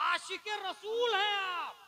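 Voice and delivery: high, drawn-out hail; mock acclamation, ringing and slow; loud acclaim. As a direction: proclaim the title at someone, stretching it. Acts out someone hailing the listener.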